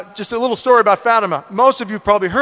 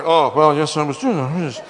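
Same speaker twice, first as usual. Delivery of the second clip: sheepish admission; 5 semitones lower than usual. Retold or imitated. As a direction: act out a husband reluctantly owning up. Caught husband sheepishly admits it.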